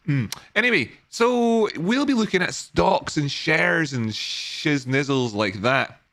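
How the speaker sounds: ordinary delivery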